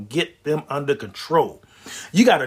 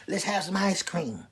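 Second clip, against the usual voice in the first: much softer, slow coax; soft, slow tempting murmur; tempting whisper; 10 dB quieter, slower than usual. Acted. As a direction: coax him softly at 2 a.m. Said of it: "talking about".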